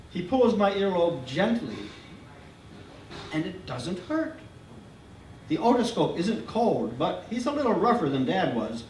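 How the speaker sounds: ordinary delivery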